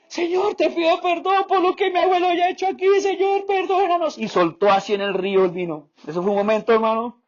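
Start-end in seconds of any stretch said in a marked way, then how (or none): 0.1-4.2 high, wailing plea for forgiveness; crying prayer. Retold or imitated. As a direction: sob it out much higher, pleading with the Lord. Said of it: "Y el chino arrancó a chillar como". (oración llorando, voz mucho más aguda)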